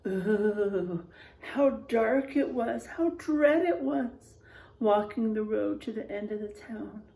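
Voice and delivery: put-on hushed, drawn-out storytelling to children; spooky story narration. A narrator's reading. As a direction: read it like a campfire tale.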